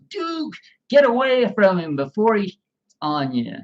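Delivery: high, loud order to the dog; father urgently calling the dog away; mock scolding shout.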